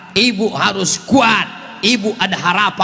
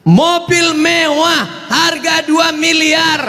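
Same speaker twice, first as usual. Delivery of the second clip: dramatic proclamation; 9 semitones higher, much slower than usual. Acted. Preacher showing off a luxury car to the crowd, dramatic proclamation. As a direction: proclaim it slowly, pitched high.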